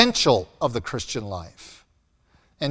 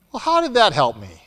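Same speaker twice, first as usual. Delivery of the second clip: ordinary talk, nothing marked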